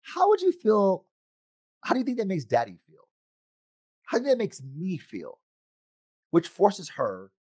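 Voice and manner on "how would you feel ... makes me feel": gentle father. Retold or imitated. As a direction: lead a daughter to see his side. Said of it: "Instead, I say to her".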